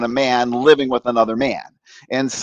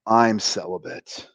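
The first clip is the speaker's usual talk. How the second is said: low, slow, soft pronouncement; solemn priest; 4 semitones lower, 5 dB quieter than usual. Retold or imitated. Priest answering a frantic teenager, solemn and calm. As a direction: say it with measured gravity.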